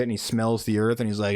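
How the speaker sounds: normal talking voice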